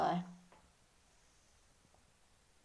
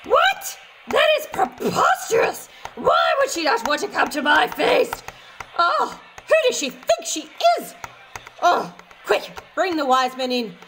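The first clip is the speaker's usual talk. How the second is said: much higher, loud outraged shout; furious king; 6 semitones higher, 7 dB louder than usual. Acted. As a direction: explode in royal outrage. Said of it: "The king was furious".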